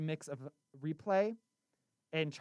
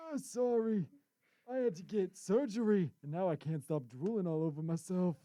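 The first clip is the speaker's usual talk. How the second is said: high, louder creaky grandpa; old man voice; 3 semitones higher, 4 dB louder than usual. Pretend. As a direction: play a drooling old grandpa. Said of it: "I do it so much better".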